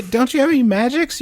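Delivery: normal talking voice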